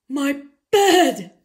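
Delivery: loud spluttered cry; sputtering outburst. A narrator's reading. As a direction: blurt it out in shock. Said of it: "Papa sputtered".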